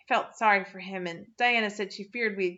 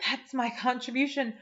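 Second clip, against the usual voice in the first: breathless gasp; 4 semitones higher, 4 dB louder than usual. A narrator's reading. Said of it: "she gasped".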